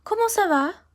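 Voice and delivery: high, bright-eyed eager question; enthusiastic. Shown as a demonstration. Teacher showing the eager way people ask comment ça va. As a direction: ask it like you're thrilled to know. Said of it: "they usually sound enthusiastic".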